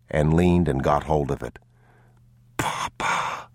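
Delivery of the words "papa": unvoiced, breathy-free hushed whisper. A narrator's reading. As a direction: whisper it so no one hears. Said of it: "the boy whispered".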